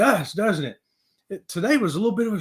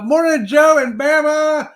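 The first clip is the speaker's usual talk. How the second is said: loud, high, slow holler; sing-out hailing call; called-out greeting; 9 semitones higher, 8 dB louder, slower than usual. Real, not acted. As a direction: stretch the names out as a hail. Calling out greeting to viewers.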